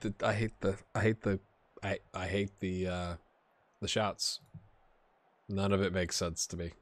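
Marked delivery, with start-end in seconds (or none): none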